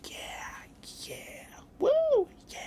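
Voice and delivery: breathy excited whisper. Retold or imitated. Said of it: "I'm just like".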